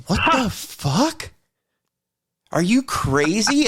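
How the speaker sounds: high, slow incredulous outburst; disbelief. Retold or imitated. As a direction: stretch it out in shock. Retold fight with test proctor.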